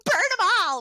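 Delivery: high, loud screamed order; crazy screaming lady. Retold or imitated. Podcaster voicing Missandei's last words, loud high scream. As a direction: scream it like a crazed woman. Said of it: "I'm doing her voice that way and that severely".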